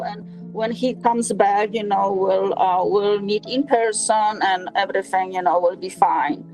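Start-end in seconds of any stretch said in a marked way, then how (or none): none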